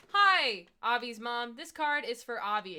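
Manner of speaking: put-on boyfriend's parents greeting mom at the grad party, overly cheerful; cheery loud greeting. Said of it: "they were like".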